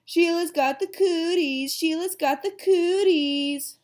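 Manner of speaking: loud, high, drawn-out chant; hollered playground taunt. A narrator's reading. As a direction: holler it back as a group taunt. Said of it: "We hollered really loud".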